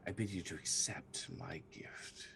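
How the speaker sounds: soft eerie whispered queen voice; sickly sweet whisper. Acted. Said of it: "the sickly sweet voice whispers to you".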